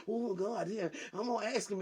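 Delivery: usual speaking voice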